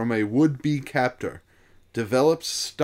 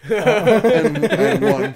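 talking through real laughter; higher, louder, breaking up laughing; 7 semitones higher, 9 dB louder, much slower than usual. Real, not acted. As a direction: laugh your way through the score. Host laughs while reading the scores.

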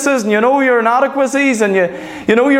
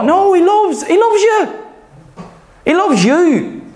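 emphatic insistence; 7 semitones higher than usual. Real, not acted. Preacher insisting to doubting believers, emphatic and high. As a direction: pitch jumps much higher on "loves".

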